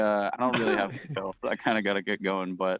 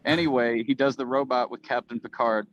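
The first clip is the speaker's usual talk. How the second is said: ordinary talk, nothing marked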